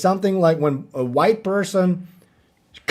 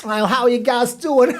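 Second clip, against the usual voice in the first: mock streamer greeting; 5 semitones higher than usual.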